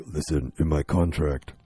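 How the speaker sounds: Vin Diesel impression. Star dictating contract demands, low slow mock voice. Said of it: "he's like".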